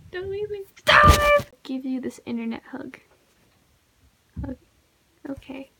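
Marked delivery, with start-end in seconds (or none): 0.1-0.6 higher, more desperate plea; pleading higher. Real, not acted. 0.8-1.5 sudden shout. Real, not acted. Emotional farewell, sudden outburst.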